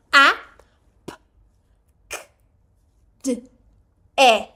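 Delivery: each English letter sound said clearly, one by one. Shown as a demonstration. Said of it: "A alfabetização foca no som". (sons das letras em inglês, pausados)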